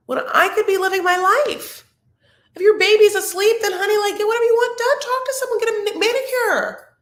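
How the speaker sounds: big excited rally cry; fired-up pep talk. Real, not acted. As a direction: hype her up like a best friend. Urging a tired mom, rising passion.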